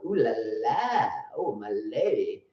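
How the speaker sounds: low, slow crooning seducer; lightly voiced suave Frenchman; seductive lover voice. Seductive French coach impression.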